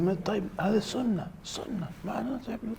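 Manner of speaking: high-pitched mock objection; retold French brother. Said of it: "the French brother".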